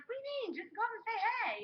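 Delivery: high-pitched, incredulous nudge; incredulous urging. Retold or imitated. Urging a shy friend to say hey, high and incredulous.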